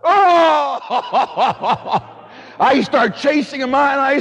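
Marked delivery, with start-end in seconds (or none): 0.0-0.8 loud high outraged "Oh!"; shocked gasp. Retold or imitated. Priest reacting to a rude student, shocked. 0.8-2.5 real burst of laughter; laughing. Real, not acted. Priest laughing at his own anger.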